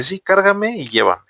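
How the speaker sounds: put-on an old man pressing his request; old man insisting. Old man voiced in story.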